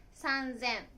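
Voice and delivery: 三千 read "san... zen", voiced z; sanzen. Shown as a demonstration. Teacher counting thousands for learners, san-zen with a z. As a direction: stretch 三, then say 千 as "zen". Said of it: "三だけ".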